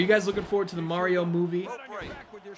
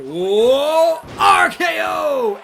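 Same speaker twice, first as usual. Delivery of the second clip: drawn-out shouted call, much higher and louder; finisher call; 8 semitones higher, 12 dB louder, much slower than usual. Real, not acted. Gamer streaming a wrestling game calls the finisher, a slow shout. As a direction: yell it like the arena just exploded.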